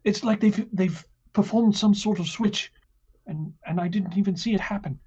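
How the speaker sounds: high, fast, panicked driver voice; flustered cart driver. Acted. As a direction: babble it out, high and rushed.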